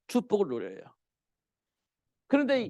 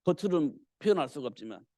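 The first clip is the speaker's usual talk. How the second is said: ordinary talk, nothing marked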